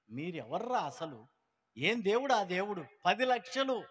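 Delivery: angry shout. Retold or imitated. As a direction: bark it out like a furious father refusing. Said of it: "అన్నాడు". (కోపంతో గట్టిగా అరవడం)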